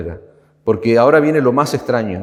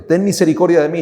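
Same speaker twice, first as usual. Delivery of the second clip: mild mock cry; 5 semitones higher, faster than usual. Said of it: "gritando allí al Señor".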